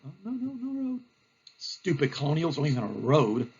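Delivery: low, grumbling mock commander; hammed-up disbelief, dropping into a grumble; blustering general. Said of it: "And Bulldog".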